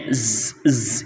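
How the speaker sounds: isolated buzzing ز, made twice; just the whistling consonant ز, no vowel; whistling sound. Shown as a demonstration. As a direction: sustain ز as a sharp "zzz". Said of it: "اس طرح کی آواز آئے گی".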